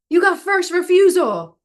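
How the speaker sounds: high, loud excited call; excited agent. Retold or imitated. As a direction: burst in with good news. Agent announcing news.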